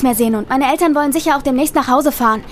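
almost shouting. A narrator's reading. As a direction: call out urgently to be heard over the rain. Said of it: "schrie meine Cousine fast, um den lauten Regen zu übertönen". (fast schreiend, den Regen übertönend)

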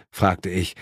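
ordinary delivery

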